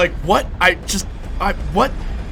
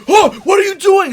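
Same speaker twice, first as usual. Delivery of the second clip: startled question; 7 dB louder than usual. Retold or imitated. Acted sketch, startled character.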